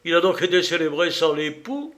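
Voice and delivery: taken aback, voice lifted; astonished question. A narrator's reading. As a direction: exclaim it in disbelief. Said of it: "s'écria Edward".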